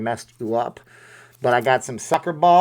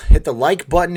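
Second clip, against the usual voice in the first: Minnesota-free, louder punchy call: "Hit the like button"; loud call to viewers; 3 semitones higher, 7 dB louder than usual. Acted. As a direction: shout the ask to hit like, louder. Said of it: "calling viewers to like".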